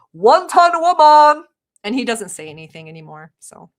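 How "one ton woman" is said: very loud, high, stretched holler; full yell. Retold or imitated. Wife yelling up to husband doing the bathroom, drawn-out holler. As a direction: belt it out slowly to another floor. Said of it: "I'll just yell".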